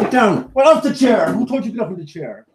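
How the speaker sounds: high, mock-stern telling-off; melodramatic scolding. Owner playfully ordering the dog off the chair, melodramatic. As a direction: put on an indignant high-pitched scold. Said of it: "It's a bit melodrama".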